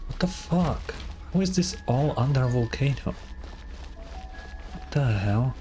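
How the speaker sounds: ordinary talk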